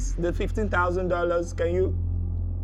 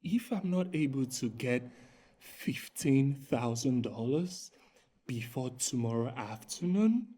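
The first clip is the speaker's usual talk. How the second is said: pleading softly; 8 semitones lower, 8 dB quieter than usual. Acted. Scammer pleading on phone softly.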